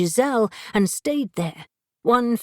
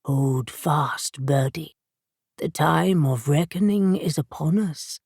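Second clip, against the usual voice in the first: lower, resonant otherworldly voice; a cat spirit speaking inside her head; 4 semitones lower than usual. A narrator's reading. Hades speaks into Birdie's mind, low and resonant. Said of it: "his voice resonant in her head and heart".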